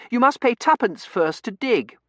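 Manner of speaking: put-on high, loud child voice; high-pitched childish insistence; bossy little girl. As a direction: lay down the rules like a prim child. Child lays down rules.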